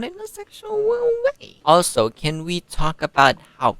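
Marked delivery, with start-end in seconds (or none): 0.0-1.6 mock disclaimer in a squeal; silly squeaky voice. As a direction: climb into a comic falsetto. Joking repeat of own disclaimer.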